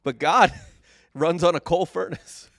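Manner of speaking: laughing, voice high and loud; giggling through the words. Real, not acted. Podcast host laughing at joke about God's coal furnace, high and loud.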